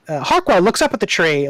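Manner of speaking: ordinary talk, nothing marked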